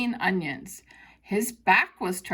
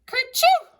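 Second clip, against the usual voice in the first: a sudden high, loud sneeze, hammed up for children; 14 semitones higher, 8 dB louder than usual. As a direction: burst out with a big comic sneeze. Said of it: "Peter sneezed".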